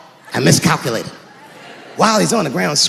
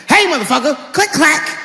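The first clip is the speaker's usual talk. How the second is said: menacing warning; 6 semitones higher than usual. Acted. Acting out confronting intruder.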